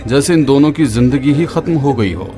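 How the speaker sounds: ordinary delivery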